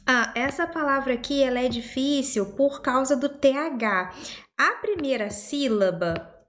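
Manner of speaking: normal talking voice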